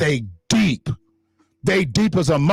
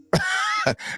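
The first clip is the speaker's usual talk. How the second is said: high mocking laugh; 5 dB quieter than usual. Real, not acted. Mocking laugh after quote.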